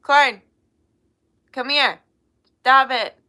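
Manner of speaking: loud telling-off from across the room; scolding call. Real, not acted. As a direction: shout a sharp order to stop. Scolding one going crazy off-mic.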